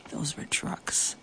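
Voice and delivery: hushed, breathy aside to the listener; whispered aside. A narrator's reading. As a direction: drop to a secret whisper.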